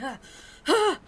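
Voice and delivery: high startled cries as he jerks awake; startled yelps. Acted. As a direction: gasp awake in shock. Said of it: "and he's like".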